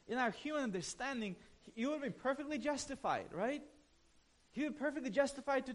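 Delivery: ordinary delivery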